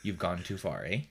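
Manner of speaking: low, softened mock Canadian; lightly voiced Canadian with "eh?"; Canadian giving directions. Shown as a demonstration. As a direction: give directions in a put-on Canadian voice. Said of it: "you're gonna tell me to go".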